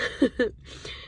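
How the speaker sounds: small breathy laugh while crying; self-aware laugh. Real, not acted. Laughing at crying on camera, self-aware laugh. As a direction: laugh at yourself through tears. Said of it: "I'm laughing because I know that".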